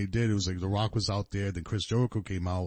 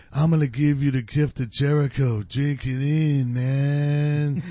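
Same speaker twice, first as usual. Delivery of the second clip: stoner voice; 5 semitones higher, 5 dB louder, slower than usual. Retold or imitated. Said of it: "sounds like a stoner in a Cheech and Chong movie".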